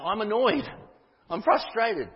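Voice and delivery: a preacher voicing Paul's anger; forceful frustration. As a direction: let the annoyance burst out. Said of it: "he could probably say that more forcefully".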